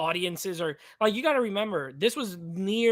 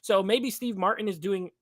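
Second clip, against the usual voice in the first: ordinary delivery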